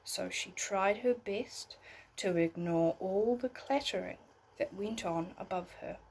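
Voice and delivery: put-on gentle storybook read for a child at bedtime; bedtime story narration. A narrator's reading. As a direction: tell it like a bedtime tale, unhurried.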